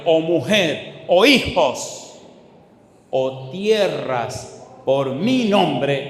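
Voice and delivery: slow, loud, weighty reading of scripture; preaching at full force. Acted. Preacher to congregation, slow loud proclaiming. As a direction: lean on each word, drawing it out. Preacher reads Jesus' words.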